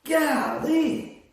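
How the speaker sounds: high, loud burst of awe; a big shouted wow, half joking; amazed exclamation. Retold or imitated. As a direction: blurt it out, floored by his skill. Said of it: "you're like".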